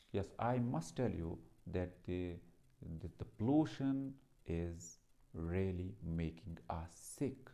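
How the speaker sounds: slow, low delivery with deliberate pauses; low-pitched demo speech, slow and composed; measured pauses. Shown as a demonstration. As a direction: perform each phrase slowly, pausing for the audience. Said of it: "you are performing your words with pause".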